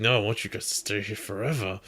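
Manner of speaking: aggressive. Retold or imitated. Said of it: "he's getting really aggressive about it".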